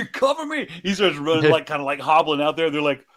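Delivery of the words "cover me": high, urgent-sounding imitation; mock battle call. Character hobbling out there, high mock battle call. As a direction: pitch it up as a call to comrades. Retold film character calling for cover.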